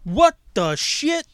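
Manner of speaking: slow, high disbelief; surprised. Real, not acted. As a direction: drag it out in shock. Shocked reaction.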